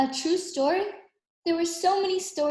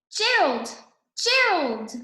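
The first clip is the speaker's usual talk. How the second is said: a higher, brighter-voiced excited pig calling her friend; playful piggy voice, a bit higher; excited Piggie; 6 semitones higher than usual. A narrator's reading. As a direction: call out to Gerald with glee. Piggie voiced in picture book.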